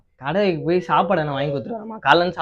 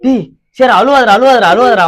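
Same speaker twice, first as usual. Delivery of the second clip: loud, raised-pitch pleas to stop crying; frantic hushing; 4 semitones higher, 9 dB louder than usual. Acted. Father trying to stop his boy crying, frantic hushing. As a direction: talk over the child's crying, loud and urgent.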